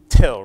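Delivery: "till" with a heavily aspirated t; long puff on till. Shown as a demonstration. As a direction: hit "till" loud with a long burst of air. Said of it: "It had that really long puff of air".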